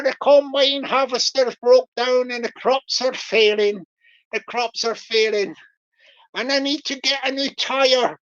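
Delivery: broad, hammed-up Bristol farmer accent; Bristol scammer voice. Scammer telling Richard of failing crops, mock Bristol accent. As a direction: lay the Bristol burr on thick. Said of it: "I'll do the Bristol voice".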